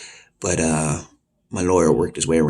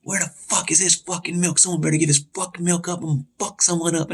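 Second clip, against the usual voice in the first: high-pitched mock yell at ordinary volume; retold angry rant, strained high; imitated yelling; 12 semitones higher than usual. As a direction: imitate a furious inmate yelling, voice pushed way up. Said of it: "he's just yelling".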